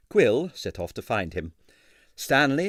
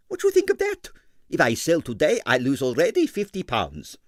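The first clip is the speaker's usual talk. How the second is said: put-on high, heavily accented excitable impresario; Stroganoff's Russian accent; 6 semitones higher than usual. A narrator's reading. Ballet impresario to the detective, in a Russian accent.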